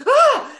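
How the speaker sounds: loud, high acted gasp; startled gasp. Woman spots an old acquaintance, loud acted gasp. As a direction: gasp sharply in shock. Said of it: "she goes".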